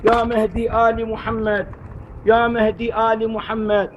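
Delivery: ordinary delivery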